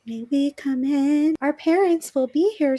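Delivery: put-on creepy kids in unison. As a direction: sing it like ghost children. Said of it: "I imagine it in unison where they're like".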